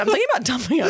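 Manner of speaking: breaking into laughter; laughing. Real, not acted. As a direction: giggle through the joke. Joking about her partner.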